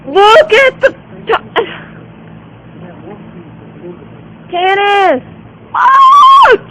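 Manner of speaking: high excited call; excited gasp. Real, not acted. Excited discovery calling friend.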